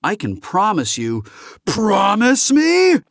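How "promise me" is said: high, loud, incredulous roar; furious bellowed question. Acted. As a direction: throw the words back in slow outrage. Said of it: "Lars Wingetog bellowed".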